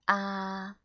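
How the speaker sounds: long, even "Ah" without rise or fall; first tone. Shown as a demonstration. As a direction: keep "Ah" on one flat pitch to the end. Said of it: "the first tone is horizontal".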